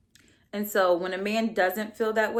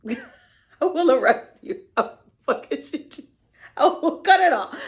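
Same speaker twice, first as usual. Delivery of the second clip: laughing mock threat; 6 semitones higher, 7 dB louder than usual.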